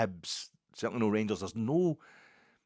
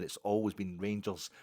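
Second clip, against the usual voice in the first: usual speaking voice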